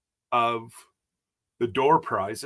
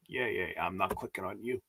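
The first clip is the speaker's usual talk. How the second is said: dismissive mutter; 7 semitones lower, 12 dB quieter, faster than usual. Real, not acted. Brushing off a security popup.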